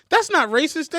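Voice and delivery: loud, high retold denial; indignant protest. Retold movie character protesting innocence.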